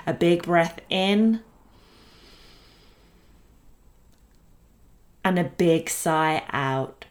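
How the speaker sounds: slow calm breathing guidance; guided meditation. Acted. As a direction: lead the breath gently, unhurried.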